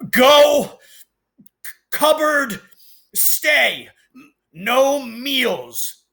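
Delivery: high, loud, choked single-word barks; furious, barely speaking. Acted. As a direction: spit each word out, too angry for sentences. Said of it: "so angry he could hardly speak".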